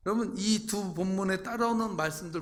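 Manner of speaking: ordinary talk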